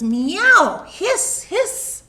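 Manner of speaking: hammed-up cat noises; cat meow and hiss. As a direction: play a spitting cat for the kids. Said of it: "The cat says".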